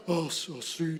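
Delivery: mock deep divine voice; deep movie God voice. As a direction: try out a grave Hollywood God voice and fall short. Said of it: "no me sale, nunca me va a salir".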